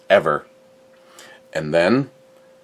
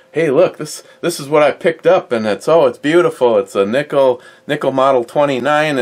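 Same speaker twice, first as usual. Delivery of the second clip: higher-pitched, imitating a friend's delighted reveal; excited show-off; 7 semitones higher than usual. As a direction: gush as if showing off a new find, retold. Retells Ed's excited video showing off.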